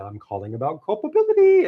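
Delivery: high sing-song announcement; Oprah Winfrey. Retold or imitated. Star calling an author about the book, imitated sing-song. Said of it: "sing-songs her way through the call".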